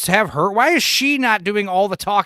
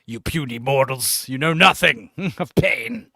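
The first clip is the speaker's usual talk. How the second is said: slow, menacing monster voice; dragon voice; 2 semitones lower than usual. Pretend. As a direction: growl it out like a demon lord. Menacing villain speech in skit.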